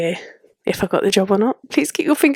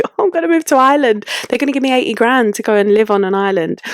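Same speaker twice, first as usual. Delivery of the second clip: higher-pitched imitation of gullible punters; mock-thrilled, starting high and easing down; mock excitement; 4 semitones higher than usual. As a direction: gush it like someone who believed the headline. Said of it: "loads of people were like".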